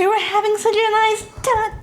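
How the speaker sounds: squeaky high sing-song. Pretend. Showing off a high cartoon voice to friends. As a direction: pitch it way up, cartoonish. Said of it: "my voice literally cannot go up that high".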